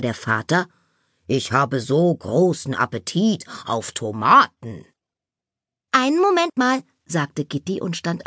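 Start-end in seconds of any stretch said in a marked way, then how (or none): 1.3-4.8 lightly voiced father, lower pitched; a hint of a father, dropped in pitch; father's voice. A narrator's reading. Father tells family of his appetite, lightly voiced low. 5.9-6.8 lightly voiced girl, higher pitched; young girl's voice. A narrator's reading. Gitti answers her father, lightly voiced high.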